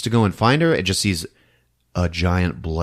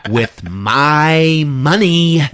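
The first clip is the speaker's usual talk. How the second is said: snide warning; 9 semitones higher, 7 dB louder, slower than usual. Retold or imitated. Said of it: "Rosemary stops him and is like".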